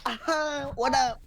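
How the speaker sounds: cocky mock greeting. Voicing Pokemon switching in cockily.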